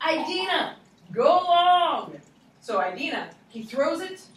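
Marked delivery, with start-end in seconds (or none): 0.1-2.2 a higher, louder call down the street. Retold or imitated. Noodle man calling to Idina to go long, loud higher call. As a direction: shout the pass call to a friend. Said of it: "he goes".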